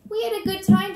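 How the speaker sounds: high, loud taunt; defiant child. Acted. Daniel answering Mom's lecture, defiantly. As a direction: boast it back at the grown-ups.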